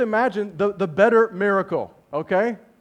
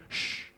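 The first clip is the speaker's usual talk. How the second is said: soft drawn shush; acted hush, all breath; hushing sound; 11 dB quieter than usual. The one who created it all speaking, hushing.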